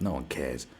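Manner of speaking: low dismissive mutter. Real, not acted. Dismissing the haters.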